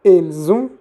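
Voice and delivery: "Elles ont" joined with a voiced z; careful French model of "Elles ont", z between the words; liaison. Shown as a demonstration. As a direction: link "Elles ont" into el-zon, clearly. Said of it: "Se hace la liaison".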